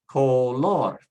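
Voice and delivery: color as co-LOR, loud on LOR; emphatic co-LOR for color; Spanish last-syllable stress. Shown as a demonstration. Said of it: "stress on the last syllable".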